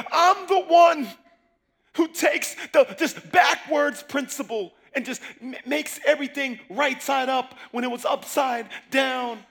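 rising sermon roar; preaching at full force. Acted. Preaching God's words.

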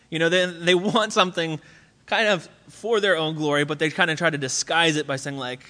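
ordinary talk

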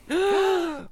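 sharp delighted gasp; surprised gasp. Real, not acted. Surprise at the gift.

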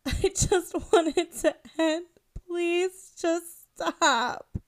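high, slow, sobbing plea; pleading while sobbing; crying plea. A narrator's reading. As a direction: beg through tears. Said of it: "I cried".

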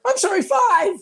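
very high squealed comic apology; escalating mock apology. Indonesian outdoing the New Yorker in humility, squealed mock apology. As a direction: leap into a frantic falsetto to outdo him.

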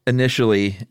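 ordinary talk

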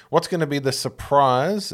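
normal talking voice

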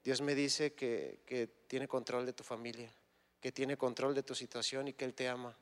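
José gently calming a worried jailer; tender, unhurried and hushed; gentle comfort. Retold or imitated. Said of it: "José le dijo".

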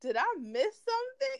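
high-pitched mock disbelief; Chloe. Retelling sister staring during business meeting, mock baffled. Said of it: "Chloe was just staring at her like".

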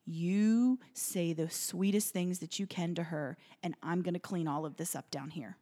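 quiet, firm comfort; gentle reassurance. Retold or imitated. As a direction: imitate a calm, motherly helper. Woman calms panicking mother.

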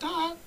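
high-pitched mock fight yelp; a short, high martial-arts "ah"; imitated kick cry. Retelling the crane kick to friends, imitated kick cry. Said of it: "hit that crane stance and went".

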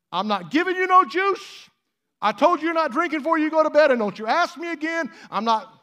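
high, loud, fast scolding mother; scolding mom. Retold or imitated. Preacher voicing a mom scolding her child at bedtime, high and loud. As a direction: mom refusing the kid juice at bedtime. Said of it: "Your mom ever told you that?".

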